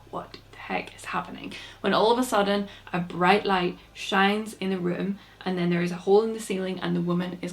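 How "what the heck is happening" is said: hushed, whispered disbelief; bewildered whisper. Acted. Logan feels the ghost's cold breath, bewildered whisper. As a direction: whisper it, spooked.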